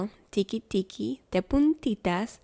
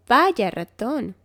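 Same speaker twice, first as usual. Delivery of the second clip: hare speaking; 3 semitones higher, 6 dB louder, slower than usual. A narrator's reading. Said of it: "dice liebre".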